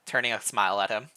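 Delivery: ordinary delivery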